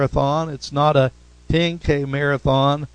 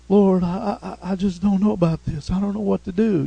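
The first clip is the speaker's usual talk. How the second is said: higher-pitched, hesitant mock prayer; acted doubt; 4 semitones higher, faster than usual. Believer second-guessing before the Lord, acted doubt. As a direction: stammer into it, voicing a doubting believer. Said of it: "Doubt hinders us".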